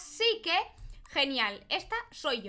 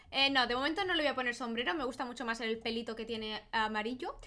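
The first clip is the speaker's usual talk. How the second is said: normal talking voice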